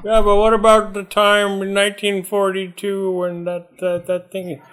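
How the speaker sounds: put-on high rambling old grandpa; old man voice. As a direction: keep up the doddering Pawpaw act.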